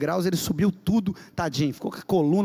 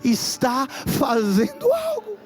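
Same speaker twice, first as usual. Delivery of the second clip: high, loud tearful preaching, slowing down; impassioned preaching; 10 semitones higher, 4 dB louder, slower than usual. Real, not acted. Preacher crying out God's words to the congregation, high and loud. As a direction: preach at full force, voice crying out.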